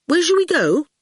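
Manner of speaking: put-on cheeky boy brushing off a giant; young boy. A narrator's reading.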